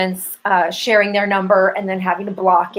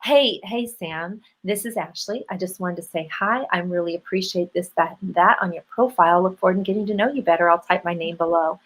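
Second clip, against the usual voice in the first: soft, lower introduction after the opening hey; sample voice memo; 3 semitones lower, 4 dB quieter than usual. Shown as a demonstration. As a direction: demonstrate a gentle, low-key hello to a match. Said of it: "So you go something like this".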